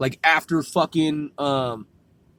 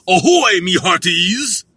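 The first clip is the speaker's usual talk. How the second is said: pirate hail; 3 semitones higher, 7 dB louder than usual. Pretend. Practicing a pirate greeting, loud and hammed-up. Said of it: "I gotta work on that".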